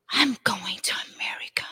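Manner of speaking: zacht gefluisterd, bijna zonder stem; whisper. Retold or imitated. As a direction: fluister het als een geheim. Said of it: "And I go".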